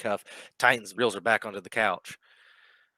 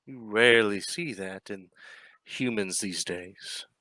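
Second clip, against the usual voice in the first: amused villain; slower than usual. Acted. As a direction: savour every word like a pleased predator. Villain admires prisoner, put-on voice.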